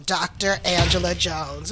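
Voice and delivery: usual speaking voice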